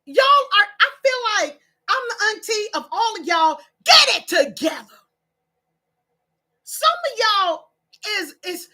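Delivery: loud, exasperated telling-off; scolding auntie. Real, not acted. Auntie of all the viewers, loudly scolding them. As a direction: burst out loud, punching the final order.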